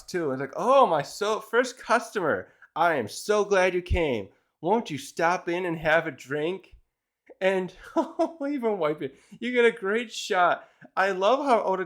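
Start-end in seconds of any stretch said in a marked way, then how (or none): 0.6-6.7 winning charm. Retold or imitated. Bar owner welcoming her first customer to win him over, charming. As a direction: imitate a hostess laying on the charm, slowly. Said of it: "she's trying to, you know, win over Rocks".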